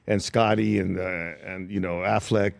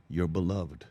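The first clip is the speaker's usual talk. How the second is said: quiet tribute; 4 semitones lower, 7 dB quieter, slower than usual. Retold or imitated. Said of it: "he said".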